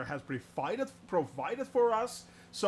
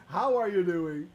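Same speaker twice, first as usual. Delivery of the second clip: ordinary talk, nothing marked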